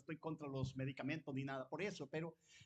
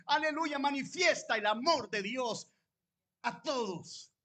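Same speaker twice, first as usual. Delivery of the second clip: preaching at full force, higher and louder; predicación a todo pulmón; 7 semitones higher, 7 dB louder than usual. Acted. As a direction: proclaim it like a fiery pastor to the church. (predicando con fuerza, más alto y más fuerte)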